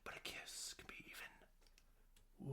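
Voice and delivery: slow breathy whisper; a slow, hushed whisper in her ear. Retold or imitated. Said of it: "he whispers in her ear".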